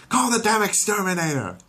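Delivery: high, loud furious order; fed up and demanding; Lois yelling. Acted. Lois ordering Peter to call pest control, high and furious.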